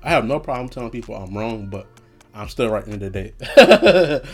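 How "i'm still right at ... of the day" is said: laughing. Real, not acted. Laughing brag after admitting wrong.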